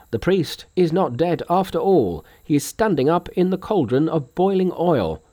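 high-pitched alarmed report; consternation. A narrator's reading. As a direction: officials reporting to the king, mildly panicked. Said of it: "In consternation".

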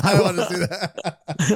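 laughing through the words; chuckling, slowed. Real, not acted. Laughing repeat.

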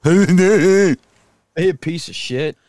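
slow loud mock outrage, joking; mock indignant drawl. Joking about the cyclist he beat, slow loud mock scorn.

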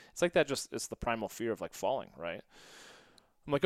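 ordinary delivery

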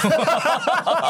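a high burst of real laughter; laughing at the friend. Real, not acted. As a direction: crack up at the tease. Teasing about happy weight.

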